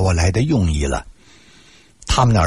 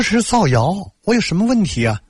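higher-pitched retort, mildly indignant; retold protest, pitched well up; 10 semitones higher than usual. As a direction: push back against a false accusation. Retold protest to the principal's accusation.

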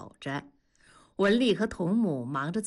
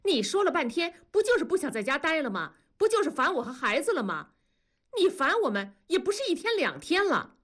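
higher, fast, heated scolding; wife losing her temper, words tumbling out higher; angry wife; 7 semitones higher than usual. A narrator's reading. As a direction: fire back at him, voice pitched up and rushing. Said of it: "文丽也火了".

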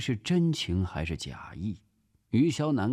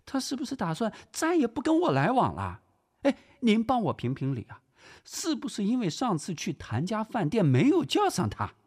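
very high, fast, theatrical female voice; high-pitched dramatic grumbling; aggrieved lady friend; 12 semitones higher than usual. Acted. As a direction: pour out a wronged friend's complaint. Theatrical aggrieved woman visitor.

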